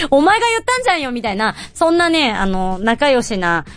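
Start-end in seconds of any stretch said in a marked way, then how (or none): 0.1-1.1 teasing retort. Retold or imitated. Actor retorting to co-star.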